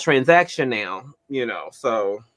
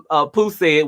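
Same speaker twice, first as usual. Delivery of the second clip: ordinary talk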